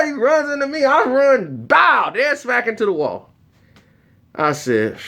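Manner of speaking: ordinary talk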